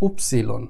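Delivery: উপসিলন said low and soft, falling at the end; উপসিলন spoken quietly and clearly; German Y sound. Shown as a demonstration. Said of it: "কয়েকবার একটু try করলে".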